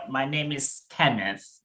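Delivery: ordinary delivery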